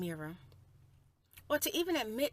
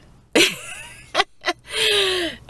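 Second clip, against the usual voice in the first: loud laugh; 10 semitones higher, 15 dB louder than usual. Real, not acted. Laugh amid loud sign-off.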